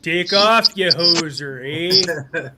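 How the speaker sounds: loud mock Canadian accent; Bob and Doug McKenzie. Canadian imitating a movie duo's insult, loud hoser accent. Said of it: "We sound like Bob and Doug McKenzie".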